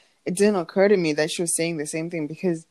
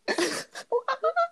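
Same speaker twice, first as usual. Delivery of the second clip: high shrieking laugh; 4 dB quieter than usual. Real, not acted. Laughing after hooting.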